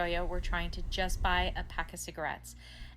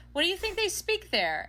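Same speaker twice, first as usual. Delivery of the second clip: high, louder burst of a question; incredulous question; 12 semitones higher, 5 dB louder than usual. Retold or imitated. Customer finally asking the clerk, high incredulous question. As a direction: blurt it out high, unable to resist.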